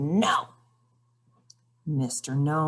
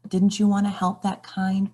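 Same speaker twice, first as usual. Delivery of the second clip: usual speaking voice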